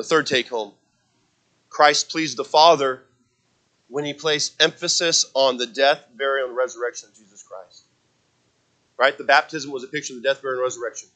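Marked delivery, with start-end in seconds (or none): none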